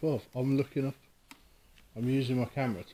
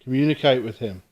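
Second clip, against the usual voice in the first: calling out; 5 dB louder than usual. Real, not acted. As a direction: project your voice into the empty room. Calling out to a spirit.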